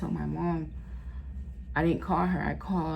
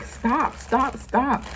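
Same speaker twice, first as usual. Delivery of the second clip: urgent doctor calling stop; 6 semitones higher, much slower than usual. Retold or imitated. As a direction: halt everyone at once. Doctor running in, halting surgery.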